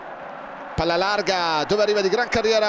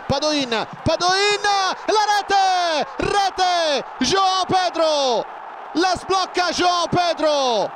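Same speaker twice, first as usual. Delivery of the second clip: goal commentary shout; 8 semitones higher, much slower than usual. Real, not acted. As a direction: erupt for the goal. Goal scored, commentator erupts.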